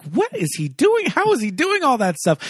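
high, wide-eyed wonder; amazed child. Retold or imitated. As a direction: gasp it out like a dazzled kid. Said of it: "I was just like".

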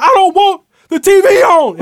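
high, loud angry outburst; angry shout. Retold or imitated. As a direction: yell it at the whole room. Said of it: "And I was like".

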